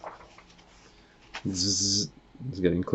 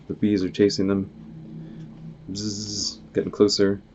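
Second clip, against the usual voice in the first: ordinary delivery, nothing marked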